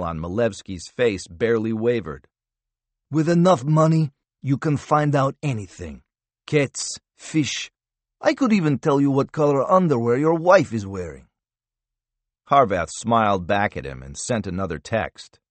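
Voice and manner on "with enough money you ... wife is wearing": higher, sneering taunt; smug Russian captive. A narrator's reading. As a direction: gloat over what he knows.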